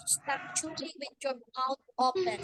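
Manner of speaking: usual speaking voice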